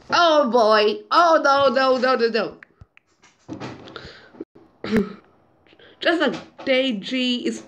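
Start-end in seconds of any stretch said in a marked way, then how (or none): none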